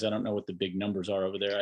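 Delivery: normal talking voice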